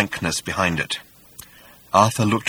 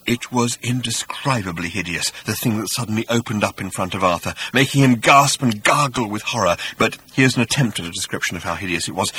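ordinary delivery, nothing marked

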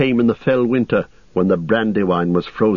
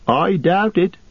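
high-pitched, glum innkeeper voice; unhappy landlord; 10 semitones higher than usual. Acted. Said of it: "said the landlord unhappily".

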